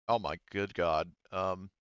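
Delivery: usual speaking voice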